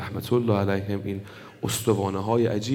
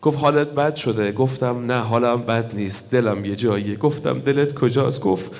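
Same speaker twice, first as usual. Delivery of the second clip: impassioned preaching; 4 dB louder than usual. Acted. Preacher telling mourners of the father's last moments, wailing. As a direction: build the grief to a full-force wail. Continues story in loud preaching.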